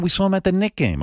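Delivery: lightly voiced wife, matter-of-fact; wife's voice. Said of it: "And she goes".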